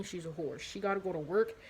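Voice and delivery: ordinary talk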